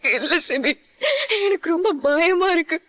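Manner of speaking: choked sobbing confession; shaky crying whisper of fear; tearful fear. Acted. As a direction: admit fear through tears. Confessing fear through tears.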